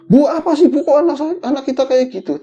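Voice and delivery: high, loud retold protest to a wife; alarmed. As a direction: act out a panicked father's question. Father's alarmed question to wife.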